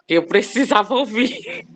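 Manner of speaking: emotional laugh breaking in; laughing through tears. Real, not acted. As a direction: laugh as the emotion spills over. Moved mother finishing her testimony. (riso emocionado, quase chorando)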